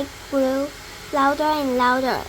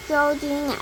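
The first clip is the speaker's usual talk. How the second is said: usual speaking voice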